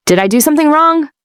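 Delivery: a lightly voiced girl afraid she's in trouble; anxious child. Ten-year-old asking her mother if she's in trouble, anxious. Emma fearful question.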